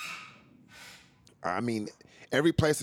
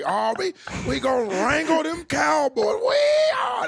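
loud, high hollering Texan cowboy; hammed-up Texas rancher shout; Texas accent; 6 dB louder than usual. Shown as a demonstration. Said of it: "a Texas accent".

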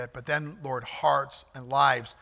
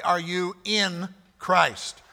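slow pointed question; 2 semitones higher, much slower than usual. Acted.

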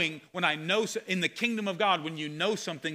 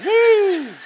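whoop; 13 dB louder than usual. Real, not acted. Reacting to the adultery excuse.

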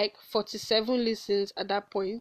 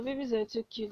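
usual speaking voice

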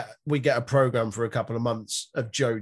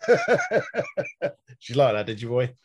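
teasing laughing; much slower than usual. Pretend.

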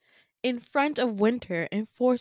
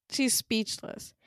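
usual speaking voice

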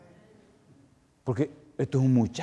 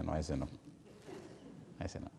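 faint refusal; 7 semitones lower, 17 dB quieter than usual. Retold or imitated. Dying mother refusing the devil.

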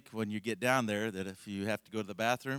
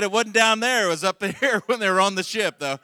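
laughing, much higher and louder; 12 semitones higher, 16 dB louder than usual. Real, not acted. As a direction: quote broken up by loud laughter, pitched higher. Punchline quote, laughing through it.

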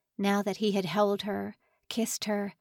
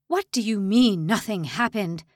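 raging; 5 dB louder than usual. Acted. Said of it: "Lang raged".

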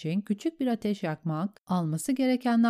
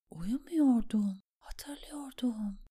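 slow, higher whisper fading out; exhausted mumbled reply; 5 semitones higher, 5 dB quieter, slower than usual. A narrator's reading. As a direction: answer faintly, nearly asleep. Exhausted Sam answers drowsily. (yavaş, bitkin fısıltı)

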